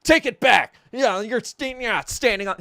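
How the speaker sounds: loud, high, straining mock outrage; hammed-up growl into an indignant demand; mock tough guy. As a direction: imitate someone playing tough. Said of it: "fucking larping too with his ... tough guy bullshit".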